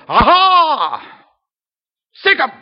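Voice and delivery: loud, high mocking cry; hammed-up glee, urging the dogs on; mock gloating, sicking dogs. Church critic cheering a preacher's downfall, loud mock glee. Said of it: "I hope you're not there going".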